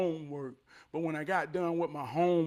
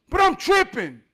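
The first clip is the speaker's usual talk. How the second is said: much louder and higher, scoffing; loud cocky scorn; 10 semitones higher, 9 dB louder than usual. Real, not acted. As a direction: belt out the disbelief at his nerve. Mocking rival who thinks he'll win.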